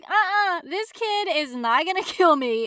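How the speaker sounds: loud, high mock indignation; hammed-up defiant outburst; acted defiance. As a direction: refuse loudly, laughing as you go. Acts out defiant character fighting back.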